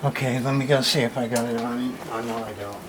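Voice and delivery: ordinary delivery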